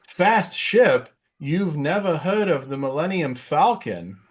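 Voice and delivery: high, slow Walken-style incredulity; Christopher Walken impression. Walken as Han, scoffing at Luke, slow and high. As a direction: ask it slowly with odd Walken pauses. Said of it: "his Christopher Walken was pretty hilarious".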